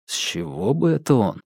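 plain reading voice

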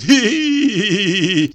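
high, slow, squealing giggle; squealing like a foal. A narrator's reading. Enraged husband beating his wife, squealing like a foal. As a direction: squeal it out like a young horse. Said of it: "как жеребёнок, повизгивает".

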